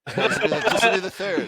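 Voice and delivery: high, loud, laughing teasing voice; laughing tease. Real, not acted. Teasing a friend about his team's finals, laughing. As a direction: laugh it out while mocking.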